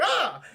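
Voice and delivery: high, loud mock scream; startled yelp. Aladdin startled by creature in the dark, yelp. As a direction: cry out in comic fright.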